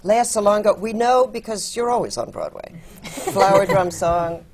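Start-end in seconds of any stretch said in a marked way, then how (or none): none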